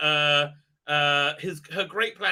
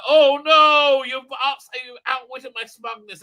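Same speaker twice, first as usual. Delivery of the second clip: mock defeated villain; 7 semitones higher, 9 dB louder, slower than usual. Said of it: "Oh, I'm gonna be smug".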